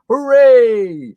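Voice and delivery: high loud little hooray; mock cheer. Recounting an old film, cheering its happy ending.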